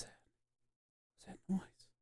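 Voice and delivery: whispered, hushed question. Real, not acted. Hearing a strange noise, whispering. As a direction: breathe it out unvoiced.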